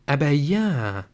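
usual speaking voice

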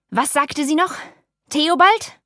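high, loud furious question; enraged outburst. A narrator's reading. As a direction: spit the name out in rage. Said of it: "Der Vater bekam einen Wutkrampf".

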